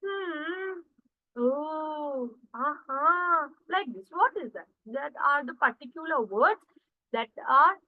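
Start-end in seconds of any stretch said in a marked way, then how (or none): 0.0-3.5 demonstrated hmm, oh, uh-huh; filler sounds. Shown as a demonstration. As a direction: show off the filler noises one by one. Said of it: "for example".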